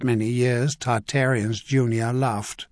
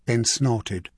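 ordinary delivery

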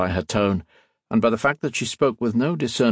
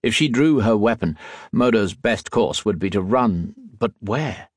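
ordinary delivery, nothing marked

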